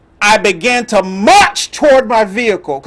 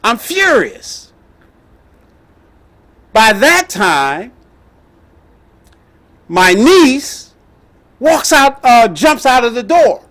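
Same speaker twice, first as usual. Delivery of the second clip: ordinary talk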